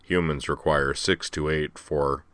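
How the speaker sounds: slow, slightly low machine intonation. A narrator's reading. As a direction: lightly imitate a droning computer. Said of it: "Another, similar voice, pitched slightly lower".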